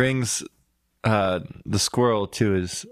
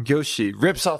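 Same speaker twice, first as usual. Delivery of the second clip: ordinary delivery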